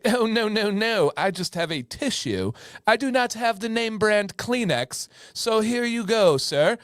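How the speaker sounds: high, prissy mock British correction; European British accent. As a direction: put on a fussy European-British voice. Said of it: "it's like a European British accent".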